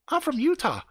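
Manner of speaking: mimicking a girl's affected high chatter; Karen's put-on voice. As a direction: imitate her fake interesting way of talking. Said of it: "maybe she does talk that way".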